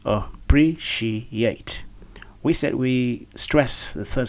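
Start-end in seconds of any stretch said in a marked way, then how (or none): 0.1-1.8 appreciate broken into uh, pree, shee, yate; syllable by syllable. Shown as a demonstration. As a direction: sound out appreciate very slowly in separate syllables. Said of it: "let's, uh, see".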